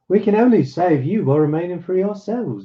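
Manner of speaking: usual speaking voice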